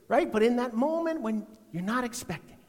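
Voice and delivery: normal talking voice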